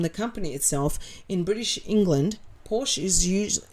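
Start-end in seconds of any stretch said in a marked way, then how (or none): none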